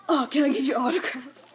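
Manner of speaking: mock fan gushing. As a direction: gush like a starstruck fan. Joking to dog in sunglasses.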